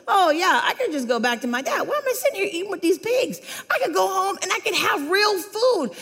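flippant son. Retold or imitated. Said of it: "he says".

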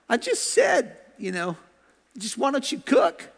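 high, innocent-sounding mock plea; defensive husband. Husband excusing his remark about cooking, high defensive plea. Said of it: "usually guys say".

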